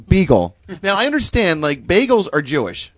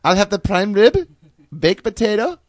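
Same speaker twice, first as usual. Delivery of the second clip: lightly voiced German mom, higher; imitating mom ordering; 5 semitones higher than usual. Son imitates mom ordering dinner, lightly voiced. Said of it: "my mom orders this".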